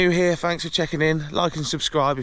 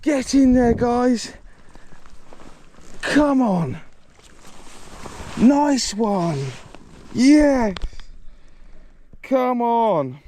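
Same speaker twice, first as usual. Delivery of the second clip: excited; 7 semitones higher, much slower than usual. Real, not acted. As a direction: urge the fish in with joy. Fighting and landing fish.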